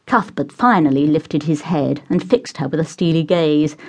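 plain reading voice

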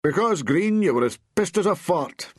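high, exasperated snap; exasperated telling-off, not shouted; snapping. A narrator's reading. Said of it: "Bob snapped".

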